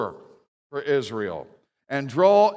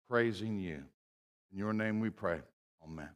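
prayer; 4 semitones lower, 5 dB quieter than usual. Acted. Pastor prays before the sermon.

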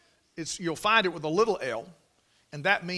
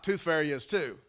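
ordinary talk, nothing marked

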